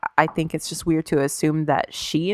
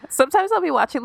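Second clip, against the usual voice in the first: laughing; 11 semitones higher, faster than usual. Real, not acted. Laughing while telling.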